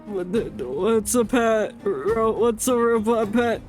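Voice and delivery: ordinary talk, nothing marked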